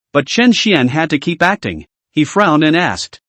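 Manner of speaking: plain reading voice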